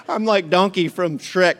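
real laugh breaking into the words; losing it laughing. Real, not acted. Preacher loses it reading the donkey's lines, really laughing. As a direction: laugh first, then squeeze the words out. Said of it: "I've lost it".

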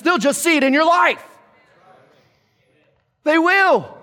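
high, emphatic preacher's declaration, building in force; impassioned preaching. Acted. Preacher telling the congregation to live for the Lord, impassioned preaching.

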